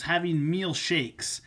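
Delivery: meal drawn out slowly and leaned on; slow down and hit "meal" in "having meal shakes"; stress on meal. Shown as a demonstration. Coach telling viewers the shakes count as a meal, stressing meal. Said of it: "I wanna emphasize the word meal here".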